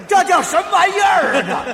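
loud, high, laughing protest; exasperated outburst. Acted. Loser protests the absurd riddle to his partner, loud exasperation. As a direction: shout in mock outrage.

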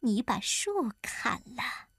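slow, whispered wife's scheme; sly, slow whisper; hushed suggestion. Acted. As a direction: whisper it conspiratorially. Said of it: "悄声对他说".

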